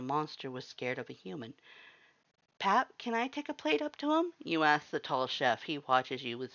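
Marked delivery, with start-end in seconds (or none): 2.6-4.3 much higher, gentle asking voice; light heroine voice. A narrator's reading. You ask the tall chef for a plate, high and hopeful.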